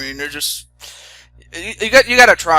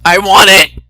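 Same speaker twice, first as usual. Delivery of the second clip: demanding; 3 semitones higher, 12 dB louder than usual. Pretend. Begging for ice cream.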